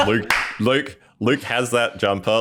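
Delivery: high, eager calling out; bubbling over; excited. Real, not acted. Excitedly calling out about listener.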